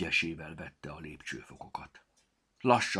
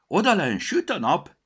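loud, much higher cry of wonder; excited shout; 12 semitones higher, 12 dB louder than usual. Acted. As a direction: call out the sunny surprise. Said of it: "kiáltott fel".

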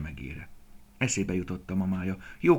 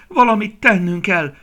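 high, very loud cry of despair; desperate shout; 13 semitones higher, 16 dB louder than usual. A narrator's reading. Young deer beside his wounded mother, shouting desperately. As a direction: cry out for urgent action. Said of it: "kiáltotta kétségbe esetten Dini".

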